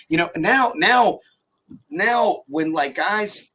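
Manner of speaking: ordinary talk, nothing marked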